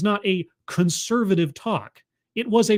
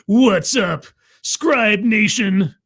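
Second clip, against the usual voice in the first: hammed-up YouTuber greeting; 4 semitones higher, 6 dB louder, much slower than usual. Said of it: "It's like".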